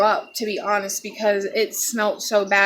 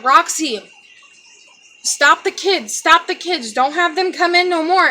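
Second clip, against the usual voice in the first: retold urgent order, raised and higher; 6 semitones higher, 4 dB louder than usual. Stepdad to mom, keeping kids away: raised urgent order. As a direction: mimic stepdad urging mom to keep kids out.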